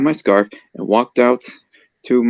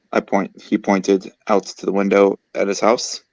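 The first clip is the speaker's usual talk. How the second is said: ordinary delivery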